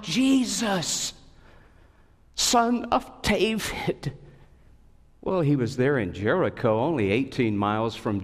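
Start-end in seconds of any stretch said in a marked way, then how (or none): 0.0-4.1 high, slow pleading call; a beggar's cry for help. A narrator's reading. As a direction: call out to Jesus from the roadside. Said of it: "he cried out".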